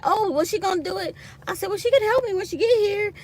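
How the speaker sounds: high bright retold reply; lightly mock her own sweet answer. Telling the coworker she can help, high bright reply.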